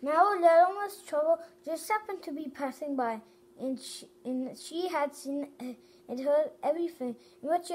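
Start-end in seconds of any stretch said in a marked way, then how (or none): none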